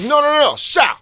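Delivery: loud, high mock fan yell; insistent fan shouting. Fans calling Sal back from security, imitated shouting. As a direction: yell over him to stop him.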